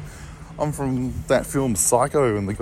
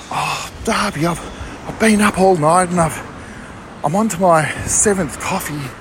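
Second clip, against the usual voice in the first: higher, frazzled mum who was up all night; mum's voice; 6 semitones higher than usual. Retold or imitated. Mum tells her son she's swamped, higher frazzled voice. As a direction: pour out an overwhelmed mum's stress. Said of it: "I just channeled the spirit of my mum".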